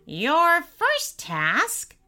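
very slow, high announcement; commanding imp. A narrator's reading. Imp sets the boy his first task, relishing it. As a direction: draw each word out with relish.

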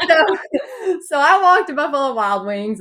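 laughter tipping into tears; laughing tearfully. Real, not acted. Remembering the bar trip, laughing tearfully. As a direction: laugh while close to crying. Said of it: "laugh and cry heard".

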